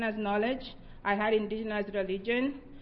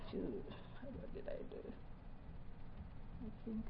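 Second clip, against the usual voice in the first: slow whispered question to herself; soft, slow self-question, barely voiced; muttered aside; 18 dB quieter, slower than usual. Real, not acted. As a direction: whisper it under your breath, checking notes. Speaker pauses, asks herself aside.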